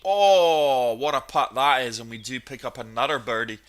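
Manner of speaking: ordinary delivery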